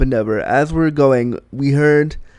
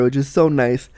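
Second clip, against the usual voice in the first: ordinary talk, nothing marked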